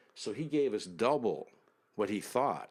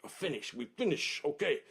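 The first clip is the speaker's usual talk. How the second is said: mildly angry retold outburst, pitched up; imitated angry driver; 6 semitones higher than usual. Soldier ending the money negotiation, imitated annoyance. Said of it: "Finally, he gets angry".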